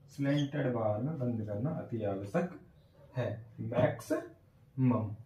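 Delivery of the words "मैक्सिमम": drawn out very slowly, sound by sound; slow demo. Shown as a demonstration. Teacher slowly sounding out a word for students. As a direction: stretch मैक्सिमम slowly into its sounds. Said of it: "आ गई ना बात समझ में?".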